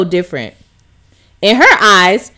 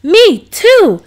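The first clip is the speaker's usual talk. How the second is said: put-on higher, louder girl's voice; excited little girl; 8 semitones higher, 5 dB louder than usual. A narrator's reading. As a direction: chirp it like an eager child. Sasha voiced.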